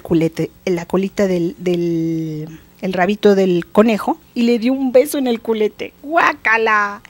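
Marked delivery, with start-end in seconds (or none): 6.0-7.0 long, drawn-out "yuck"; hammed-up disgust, very slow; disgusted exclamation. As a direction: stretch it out in comic revulsion. King kisses the hare's behind.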